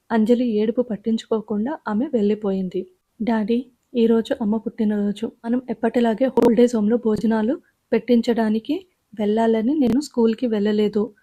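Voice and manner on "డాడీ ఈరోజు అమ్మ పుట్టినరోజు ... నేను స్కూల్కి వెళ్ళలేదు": ordinary delivery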